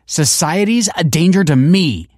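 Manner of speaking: put-on high, loud teenage indignation; indignant balking. A narrator's reading. Pupil rejecting principal's judgment of her, indignant. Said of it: "Kelsey balked".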